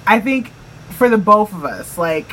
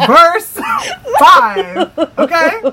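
laughing; 9 semitones higher, 6 dB louder, much slower than usual. Real, not acted. Laughing at her own joke.

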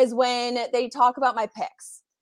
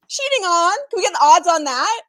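high, loud playful indignation; mock outrage; 8 semitones higher, 6 dB louder than usual. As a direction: play the wronged girlfriend, loud and high. Playing the cheated-on internet girlfriend.

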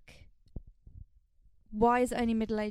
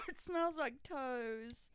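talking through a sour mouthful; eating sour candy; 7 dB quieter, much slower than usual. Real, not acted. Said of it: "That tastes crazy".

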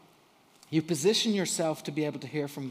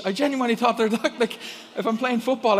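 ordinary talk

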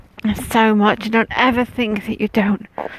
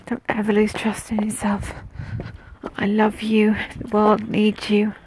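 ordinary talk, nothing marked

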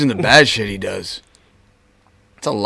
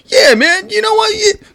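very high-pitched imitation of a creep; 3 dB louder than usual. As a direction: mimic an oddball stranger way up high. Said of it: "he sounds like a creep".

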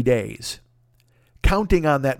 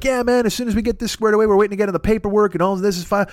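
higher, rushed easygoing assurance; breezy stalling; 7 semitones higher, faster than usual. Retold or imitated. The contact texts about waiting on paperwork, breezy stalling. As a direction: brush it off as no problem.

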